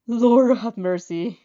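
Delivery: loud, slow drawn-out groan of dismay; loud, slow weary groan. A narrator's reading. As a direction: moan it out like the task is torture. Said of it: "you groan".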